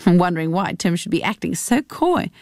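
plain reading voice

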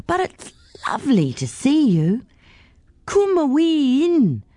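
high-pitched Scottish lady; Scottish accent. A narrator's reading. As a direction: greet them in a lilting, high Scottish voice. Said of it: "She says that 'cause she's Scottish".

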